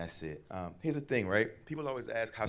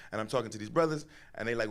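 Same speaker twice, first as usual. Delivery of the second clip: usual speaking voice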